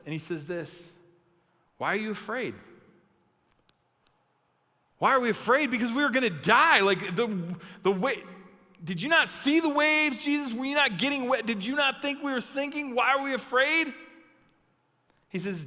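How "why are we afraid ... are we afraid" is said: high, worked-up disbelief; incredulous protest. Acted. Disciples answer Jesus after the storm, acted incredulous outburst. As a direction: throw the question back, agitated and higher.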